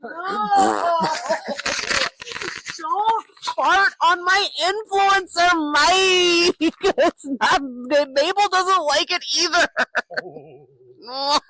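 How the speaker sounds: high laughing shriek of protest. Real, not acted. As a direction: squeal no through laughter. Brother farts on mic, laughing protest.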